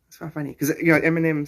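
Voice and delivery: usual speaking voice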